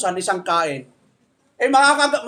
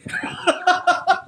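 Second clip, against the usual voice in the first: laughs; 7 semitones higher than usual. Real, not acted. Laughing at own story.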